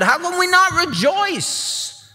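pleading father. Acted. Father pleads with elder son.

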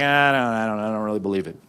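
low, trailing mumble of doubt; hesitant, unconvinced imitation; reluctant doubter. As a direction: play someone half-convinced, voice sinking. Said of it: "and we're like".

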